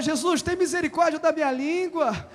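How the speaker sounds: loud, fast call to pray together; fervent group prayer. Shown as a demonstration. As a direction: lead the church in a rising plea. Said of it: "Levanta sua mão e fala".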